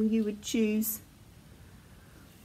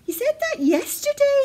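high, loud little bear voice; Baby Bear protesting; 14 semitones higher, 5 dB louder than usual. A narrator's reading. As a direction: complain like an indignant child. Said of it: "said Baby Bear".

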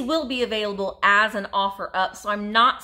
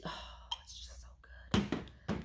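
breathless whisper of delight; hushed, delighted whisper over a vintage doily; awed whisper; 14 dB quieter than usual. Real, not acted. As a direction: whisper it like it's too pretty to say aloud. Awed by fabric colors.

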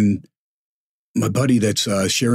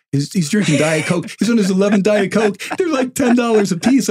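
rising retold indignation; 11 semitones higher than usual. Retold waitress explaining the bill.

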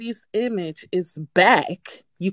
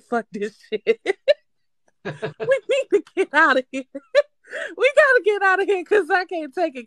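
high, laughing panic; 11 semitones higher, 3 dB louder than usual. Real, not acted. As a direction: giggle through the urge to flee. Laughing while retelling fleeing.

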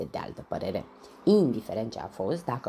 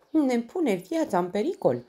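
high squeak, louder, a tiny mouse voice; squeaky mouse; 12 semitones higher, 6 dB louder than usual. A narrator's reading. Mouse witness squeaking to detective Tafiti, high squeak. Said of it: "chiță ușoricel".

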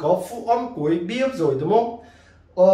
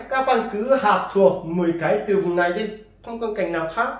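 ordinary delivery

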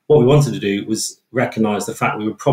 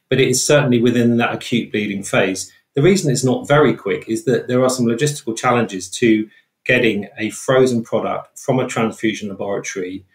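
ordinary delivery

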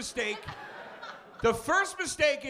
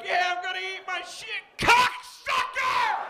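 mock furious yell; 9 semitones higher than usual. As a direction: stretch out the insult in fake rage. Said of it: "You're like".

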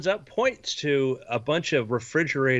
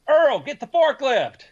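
calling out; 12 semitones higher, 5 dB louder, slower than usual. Acted. Shopkeeper calls to worker.

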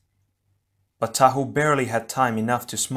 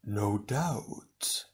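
soft, otherworldly silken reply; an unseen villain calmly dismissing them; silky villain; 8 dB quieter than usual. Acted. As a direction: let it slide out smoothly. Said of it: "the voice responded silkily".

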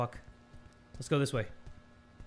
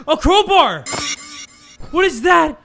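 loud, high gasp of discovery; high-pitched, drawn-out surprise; startled excitement; 7 semitones higher, 6 dB louder than usual. Real, not acted. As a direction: cry out at a sudden find. Startled find of a crowbar in game.

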